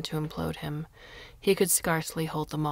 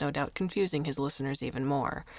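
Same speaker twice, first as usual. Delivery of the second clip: ordinary delivery, nothing marked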